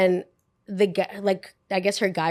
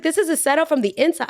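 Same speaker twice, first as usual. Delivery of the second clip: retold disbelief; 8 semitones higher, faster than usual. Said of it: "I was like".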